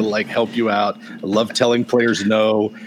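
normal talking voice

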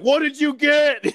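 high, loud, slow impatient demand; exasperated yell. Retold or imitated. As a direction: finally lose patience and demand the answer. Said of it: "And it's just like".

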